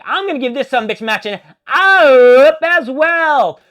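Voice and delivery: hyped-up verdict. Acted. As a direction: announce it like a big win.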